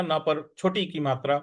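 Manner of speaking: ordinary delivery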